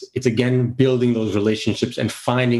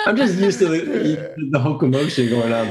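laughing it off; words broken by laughter; 4 semitones higher than usual. Real, not acted. Laughing at being teased.

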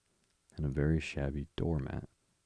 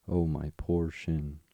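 ordinary delivery

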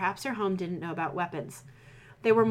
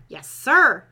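loud higher reply; called from inside; 4 semitones higher, 11 dB louder than usual. Acted. Orderly calls answer to surgeon. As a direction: call it back from inside. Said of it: "Someone called from the inside".

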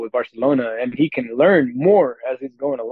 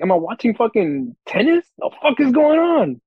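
high, rising exasperation, much higher at the end; fed up with how they play; exasperated retold rant; 10 semitones higher than usual. Fan mocking his team's play, exasperated high rant.

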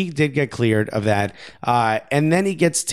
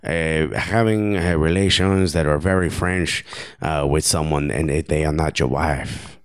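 Karim Benzema. Acted. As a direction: explain it in a put-on, muddled foreign accent. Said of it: "I promise this is Karim Benzema".

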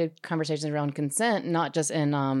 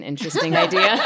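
laughing; 3 semitones higher, 7 dB louder than usual. Real, not acted. Laughs at her own word choice.

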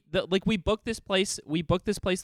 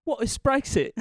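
high-pitched posh British schoolboy; mock posh British accent, pitched up; 6 semitones higher than usual. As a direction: put on a posh little English boy's question. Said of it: "You look such a posh schoolboy".

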